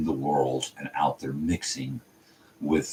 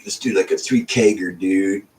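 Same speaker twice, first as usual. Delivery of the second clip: higher, louder dude-bro voice; party bro voice; 5 semitones higher, 5 dB louder than usual. Retold or imitated. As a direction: imitate a keg-party bro.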